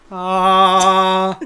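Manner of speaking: booming genie exclamation; big theatrical genie "ah". Pretend. Genie voice answers wish.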